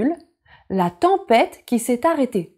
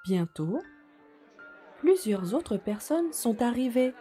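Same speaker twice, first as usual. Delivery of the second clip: soft storytelling voice; 4 dB quieter than usual. A narrator's reading. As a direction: read gently to little children. Story narration begins.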